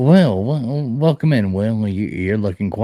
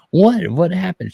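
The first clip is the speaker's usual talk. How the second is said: retold surprise; 7 semitones higher, slower than usual. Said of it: "And I'm like".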